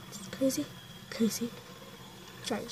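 whispered repeat of the name; whispering. Shown as a demonstration. Repeats sister's account name.

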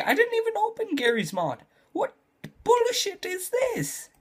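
high squeaky outrage at the game; indignant disbelief. Real, not acted. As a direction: sound stunned and cheated. Game falsely shows six hours.

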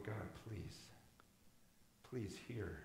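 low, soft plea to God; quietly imploring, pitch dropped; hushed pleading prayer. Retold or imitated. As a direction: pray it under your breath, retelling. Retold desperate prayer in village.

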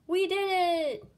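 high, loud cheer; triumphant cheer. A narrator's reading. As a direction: cheer a shared victory out loud. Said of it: "cheered the wizard".

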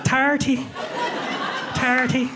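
Irish "Thirty", tapped T, bright and lifted; playful Irish brogue on "Thirty"; Irish accent. Shown as a demonstration. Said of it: "my Irish accent".

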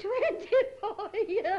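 slow, drawn-out sobbing wail; long tearful lament. Acted. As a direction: wail it out slowly through tears. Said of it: "don't cry".

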